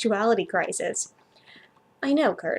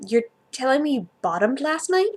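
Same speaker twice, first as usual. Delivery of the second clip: plain reading voice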